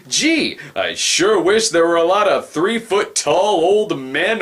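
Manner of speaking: hint of a kid wishing aloud; mock wishful kid. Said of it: "when I was a kid, I always thought".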